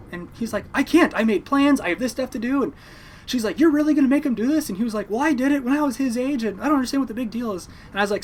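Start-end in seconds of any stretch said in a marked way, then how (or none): 0.7-2.6 high, loud defensive excuse; retold protest. Uncle making excuses to mom at dinner, defensive protest. 3.6-4.7 high, loud incredulous challenge; retold reproach. Mom pressing the uncle about her son, incredulous reproach. 5.1-7.7 high-pitched, louder self-justifying protest; retold defensive retort. Uncle defending himself to mom, indignant and high. Retells uncle arguing back.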